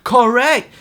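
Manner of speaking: shouted agreement at the news; loud correct. Real, not acted. Vlogger shouting agreement at the news, loud. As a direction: bark it out in agreement.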